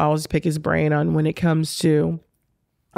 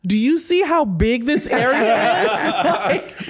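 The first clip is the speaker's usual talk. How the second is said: higher, drawn-out indignant complaint; mock upset; 7 semitones higher, slower than usual. Tennis player fuming at a stranger sitting too close, mock upset. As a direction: act out exaggerated disbelief, laughter close behind. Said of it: "so upset".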